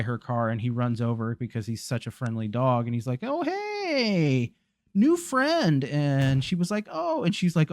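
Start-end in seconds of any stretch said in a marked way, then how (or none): 3.2-5.8 much higher, cheerful puppy voice; happy dog voice. Acted. Friendly dog greets the old lady, high and cheerful. 6.9-7.2 a high, surprised little gasp of a word; the old lady caught off guard; startled oh. Retold or imitated. Startled old lady as the dog runs up, high surprised oh.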